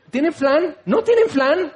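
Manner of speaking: high, whiny mock demand building up; escalating hammed-up petulance; imitated demanding tantrum. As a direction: play a spoiled customer insisting. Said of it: "así hay gente, así ora".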